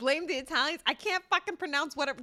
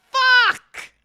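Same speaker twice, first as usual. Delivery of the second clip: loud angry swear at himself; real exasperated outburst; frustrated shout; 10 semitones higher, 14 dB louder than usual. Real, not acted. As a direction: yell it out in frustration. Frustrated at failed pronunciation.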